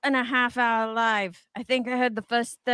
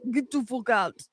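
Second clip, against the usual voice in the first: put-on a clumsy try at a Scottish accent; a failed Scottish brogue that drifts toward Arnold Schwarzenegger; bad Scottish accent; 3 dB quieter than usual. Reading a comment aloud as a bad Scottish attempt. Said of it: "That was me trying Scottish".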